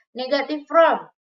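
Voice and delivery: teacher calling out the section title; loud announced heading. Shown as a demonstration. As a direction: announce the new section loudly to the class. Teacher repeats the heading twice.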